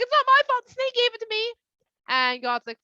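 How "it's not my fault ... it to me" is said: put-on much higher, louder childish whine; whiny man. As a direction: whine it out like a caught kid. Woman blames snake.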